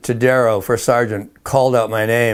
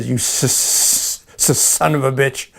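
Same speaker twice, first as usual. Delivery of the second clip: slow stammering curse, catching on the s; a mild imitation of the sergeant's furious stammer; angry stutter; 4 semitones higher, slower than usual. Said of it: "he would stutter badly".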